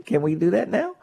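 ordinary talk

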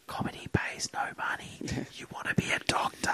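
very soft unvoiced whisper. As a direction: whisper it conspiratorially. Secret advice to nephew.

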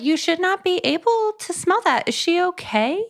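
high, incredulous squeak of disbelief; mock incredulous. Reader squeaking at the book's smell line, high and incredulous. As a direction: pitch way up, baffled and grossed out. Mock concerned reaction to book.

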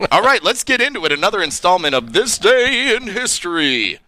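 high, quick radio-host intro; big swooping show intro; segment announcer. Acted. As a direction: launch the segment like a hyped-up announcer.

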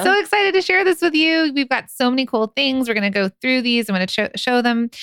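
high-pitched, gushing sales pitch; hype girl voice. Acted. Host opening a live product launch, hype girl voice. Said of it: "It's your hype girl voice".

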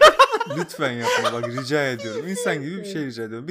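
laughing plea. Real, not acted. Laughing plea after radio sound.

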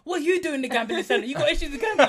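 high, loud mock telling-off, half laughing; Harry Redknapp confronting. Acting Redknapp challenging his player over gambling, mock outraged. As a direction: imitate an incredulous boss catching a player out.

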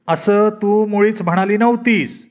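dad pushes back, spacing out each word. A narrator's reading. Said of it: "पप्पा जरा वरच्या आवाजात म्हणाले".

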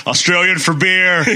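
put-on playful Australian accent. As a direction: deliver a slogan in hammy Australian. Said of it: "I would go".

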